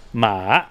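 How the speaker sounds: "Ma." dipping low then rising back up; falling-rising tone. Shown as a demonstration. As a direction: let "Ma." sink and then climb. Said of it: "the falling rising tone".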